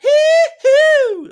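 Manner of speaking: high, loud yelp of pain through the needle; pained squeal. Retold or imitated. Retelling getting inked, a loud high pain squeal. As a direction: squeal like the tattoo hurts. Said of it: "I was sat there like".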